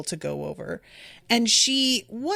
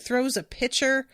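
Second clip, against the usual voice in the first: ordinary delivery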